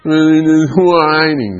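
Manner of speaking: WHINING whined high and long drawn-out. Shown as a demonstration. Acts out the word in a whine.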